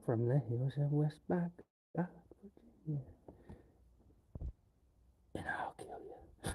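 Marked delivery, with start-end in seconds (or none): 5.3-6.5 hushed mock threat; creepy whisper. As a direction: whisper it like a horror villain.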